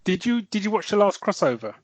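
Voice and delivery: ordinary talk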